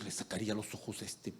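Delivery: an annoyed wife muttering about her husband; muttered threat. Retold or imitated. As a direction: grumble it under your breath. Woman muttering about a staring man.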